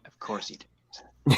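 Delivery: slow, whispered dry jab; sarcastic whisper. Real, not acted. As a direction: tease him under your breath. Teasing aside about spreadsheet.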